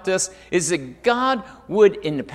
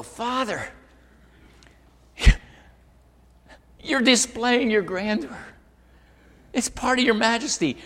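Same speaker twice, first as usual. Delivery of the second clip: awed prayer; 7 semitones higher than usual. Retold or imitated. Praying to God under stars.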